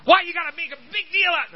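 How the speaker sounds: high indignant whine; preacher imitating a defensive listener, whiny and high; whining complaint. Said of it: "You make such a".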